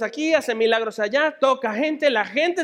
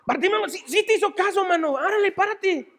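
high-pitched, eager friend's voice; excited buddy; 9 semitones higher than usual. Acted. Bartimeo's friend telling him to get up, excited buddy. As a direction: urge your pal up excitedly. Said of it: "imagínate el cuate de".

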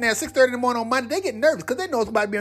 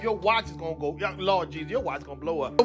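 quiet; 6 semitones lower, 7 dB quieter than usual. Pretend. Mock soothing, softer.

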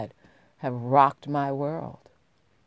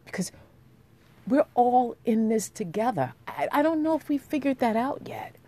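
impassioned; 8 semitones higher, 5 dB louder than usual. Real, not acted. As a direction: proclaim it with rising fervor. Impassioned speech on equality.

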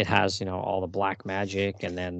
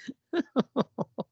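high laugh. Real, not acted. Laughing at the gruesome prank.

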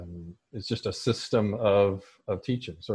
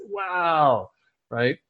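ordinary delivery

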